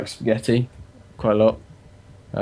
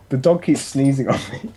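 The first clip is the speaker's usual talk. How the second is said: usual speaking voice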